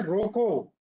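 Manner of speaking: "रोको" cut off as a complete order, falling at the end; stand-alone command. Shown as a demonstration. Teacher shows the comma's meaning, "रोको" as a separate command. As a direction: say "रोको" as a full stop-command. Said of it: "यहाँ पे हम ये कह रहे हैं".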